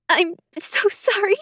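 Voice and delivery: high, tearful apology; a half-choked sorry. Acted. Emily apologizing to Sarah while crying, choked voice. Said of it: "I choked out".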